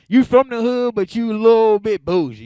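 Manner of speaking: loud, high punchline push; pumped-up comic yell. Real, not acted. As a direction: shout the punchline to the crowd.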